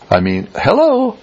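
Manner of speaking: sharply high, incredulous hello; mock disbelief. Real, not acted. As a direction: jump way up in pitch in disbelief. Mocking Jehoshaphat's lack of discernment.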